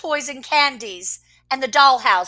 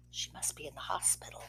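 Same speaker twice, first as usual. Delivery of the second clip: whispered aside; 8 semitones lower, 24 dB quieter, much faster than usual. Real, not acted. Parent pausing the story to whisper to the child. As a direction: murmur the comment under your breath.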